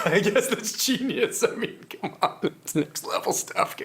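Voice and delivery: chuckling through mock praise; laughing admiration. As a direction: let laughter swallow the words. Mocking praise of the strategy.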